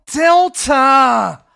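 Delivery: loud, shouted call; shouted buzzer answer. Acted. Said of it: "get into the zone here".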